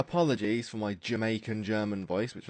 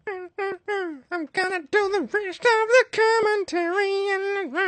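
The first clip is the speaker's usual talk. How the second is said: very high, slow and loud falsetto; silly high falsetto commentary voice; 10 dB louder, slower than usual. Shown as a demonstration. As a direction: squeal it out in a high, slow put-on voice. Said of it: "I seem to be able to do high-pitched voices".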